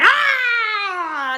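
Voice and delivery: high, drawn-out mock scream; acted monster scream. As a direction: let out a long exasperated "Ah!". Acts out her outburst at kids.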